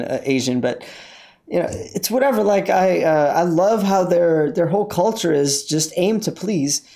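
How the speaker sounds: ordinary talk, nothing marked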